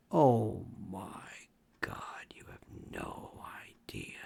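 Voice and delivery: slow, hushed oh my God; whispered aside. Real, not acted. Host confides untold stories to listeners, whispered. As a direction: lean in and whisper it.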